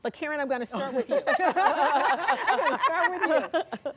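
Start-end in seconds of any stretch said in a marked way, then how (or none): 1.4-3.9 laughing through the words, higher and louder, slow. Real, not acted. Host laughing as she picks Karen first. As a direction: repeat it while cracking up.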